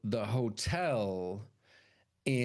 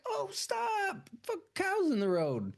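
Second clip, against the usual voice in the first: much higher, squealing at cows in the road; startled girl voice; slower than usual. Retold or imitated. Driver spotting cows in the road, high startled squeal. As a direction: squeal like a startled young woman. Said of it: "And then, like".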